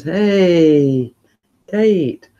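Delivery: ordinary talk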